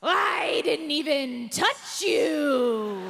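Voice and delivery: slow, loud monster growl of a reply; haunted house monster defending himself, slow and loud. Retold or imitated. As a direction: stay in character as the monster, drawn out. Monster answers in character.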